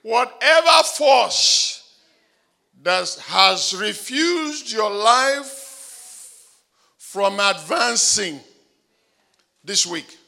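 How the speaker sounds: loud, high declaration over the crowd; fervent shouted decree; full-force preaching. Acted. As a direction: preach it with rising power.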